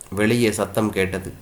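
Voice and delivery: ordinary delivery, nothing marked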